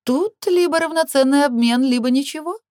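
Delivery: high, lilting sing-song delivery; airy, high-pitched female character chanting her terms. A narrator's reading. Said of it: "пропела Наама".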